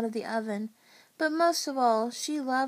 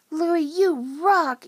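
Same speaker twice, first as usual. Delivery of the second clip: loud, slow, higher cheer; shouting; 5 semitones higher, 8 dB louder, much slower than usual. A narrator's reading. Ian cheering the pizza chef, admiring shout. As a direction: shout praise, stretching the words. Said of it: "Ian shouted".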